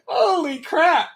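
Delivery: high, slow, teary laughing cry. Real, not acted. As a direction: squeal it out through laughter. Overwhelmed laughing reaction.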